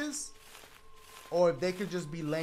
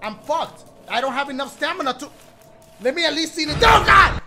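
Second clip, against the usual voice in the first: panicked high shouting; yelling in real fear, running; being chased; 7 semitones higher, 12 dB louder than usual. Real, not acted.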